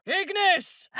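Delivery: very high fiery incantation; spell cry. Acted. Casting fire in battle, high cry. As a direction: fling the spell word out.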